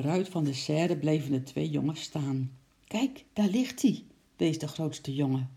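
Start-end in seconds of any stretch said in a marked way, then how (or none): 2.9-4.0 put-on eager boy voice. A narrator's reading. Boy points at the football.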